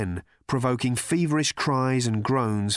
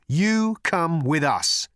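slow, raised-voice order; insistent; 6 semitones higher, 4 dB louder, slower than usual. A narrator's reading. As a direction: order it firmly, word by word. Arthur overrules Trillion.

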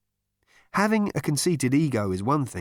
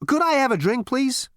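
brash Zaphod; 14 semitones higher, 4 dB louder than usual. A narrator's reading. Narrator voicing Zaphod.

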